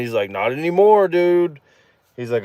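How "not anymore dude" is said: higher, louder imitation of a pal; excited friend. Friend reporting the boyfriend is gone, excited. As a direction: break the news, pitched up. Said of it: "all excited and stuff".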